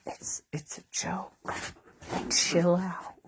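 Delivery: hushed whisper to grandma; whispered aside. Retold or imitated. Whispering to grandma that covid was a joke. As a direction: lean in and whisper it's only a joke.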